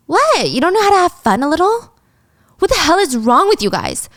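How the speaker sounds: put-on high, scoffing party girl voice; a high-voiced spoiled socialite mocking her friends. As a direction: roll your eyes at the buzzkills.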